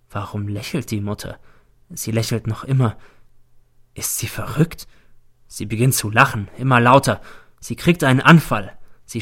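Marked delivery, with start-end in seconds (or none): 3.9-4.9 whisper. A narrator's reading. Narrator's anxious inner question.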